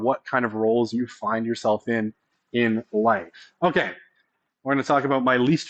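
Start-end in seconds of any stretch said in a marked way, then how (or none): none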